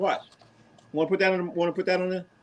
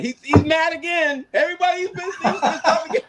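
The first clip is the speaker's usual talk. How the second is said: high-pitched laughing tease; laughing through the teasing; 11 semitones higher than usual. Real, not acted. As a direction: cackle while mocking his friend's temper.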